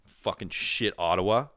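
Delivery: ordinary delivery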